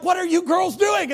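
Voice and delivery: loud, high-pitched incredulous demand; exasperated question. Retold or imitated. Youth pastor questioning whooping girls at the machine, loud mock bafflement.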